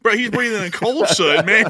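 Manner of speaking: high, loud laughing talk. Real, not acted. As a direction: crack up while talking. Laughing at cohost coughing.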